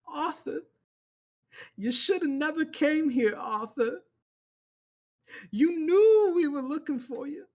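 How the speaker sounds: high, loud, threatening; menacing preacher. Acted. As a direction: call out Arthur like a zealot. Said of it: "He goes".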